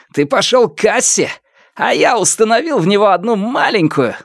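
high-pitched gloating villain; openly proud of himself. A narrator's reading. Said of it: "Он откровенно гордился собой". (самодовольно, высоким злорадным голосом)

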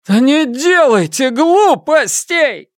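high, slow, forceful shout. A narrator's reading. Фан Му trying to hold back the mob, shouting. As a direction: shout them down, stretching the words. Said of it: "крикнул Фан Му".

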